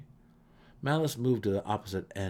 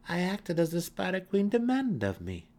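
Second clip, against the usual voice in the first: high, raised, insistent voice; defiant self-defence; 11 semitones higher, 5 dB louder than usual. A narrator's reading. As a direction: defend yourself proudly. Accused matron defends her deed.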